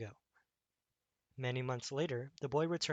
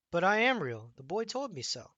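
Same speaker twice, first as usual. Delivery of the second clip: insistent protest; 5 semitones higher, 6 dB louder than usual. Acted. Rabbit insists he is real.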